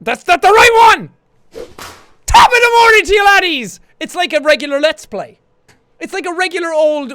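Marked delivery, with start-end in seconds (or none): 0.0-1.1 loud outburst. Real, not acted. Wrong sound bite plays. 2.2-3.8 mock Irish greeting.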